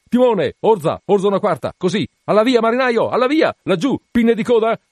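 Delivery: high, loud, urgent calls; excited captain bellowing at full pitch; shouted helm orders. A narrator's reading. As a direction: yell steering commands to the helmsman.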